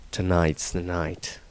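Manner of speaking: low, quiet, guarded voice; Dieter's wary entry. Acted. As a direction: murmur it as a watchful man who trusts no one. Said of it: "Extract from the Diary of Dieter".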